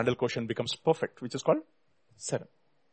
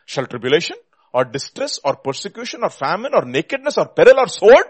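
impassioned preaching; 4 semitones higher, 8 dB louder than usual. Real, not acted. Preacher challenging the congregation with Scripture, impassioned. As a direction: build to a loud, high finish.

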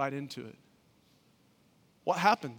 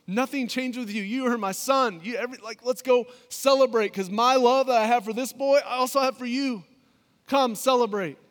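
raised, pleading father; father's voice; 7 semitones higher, 7 dB louder than usual. Acted. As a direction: call your son back home, higher. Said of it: "the father reminds him".

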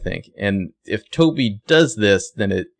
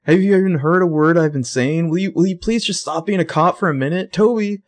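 higher, louder, frantic pleading; freaking out; 9 semitones higher, 4 dB louder than usual. Retold or imitated. Spencer freaking out as Toby arrests Johnny, high frantic pleading. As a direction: panic and beg him to stop, voice climbing. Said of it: "So Spencer's freaking out".

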